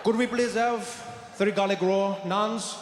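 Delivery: put-on Indian English, measured delivery; Indian accent. Showing off while ordering for the table, Indian accent. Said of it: "You got a bit of an accent".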